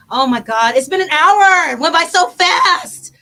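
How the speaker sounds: loud, excited burst; loud delighted exclamation. Real, not acted. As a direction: blurt it out amazed at the time. Host excited time flew by.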